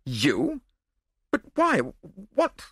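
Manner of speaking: impotent, astonished spluttering nobleman. A narrator's reading. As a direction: splutter broken questions helplessly. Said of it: "the nobleman spluttered impotently".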